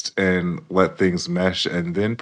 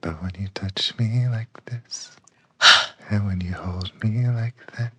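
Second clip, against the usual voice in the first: breathy whispered lyrics; 5 dB quieter than usual. Pretend. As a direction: whisper it like a love ballad. Whispering song lyrics dramatically.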